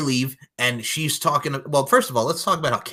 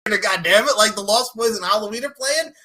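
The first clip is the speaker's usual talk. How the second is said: excited; 9 semitones higher, 9 dB louder than usual. Retold or imitated. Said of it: "I'm like".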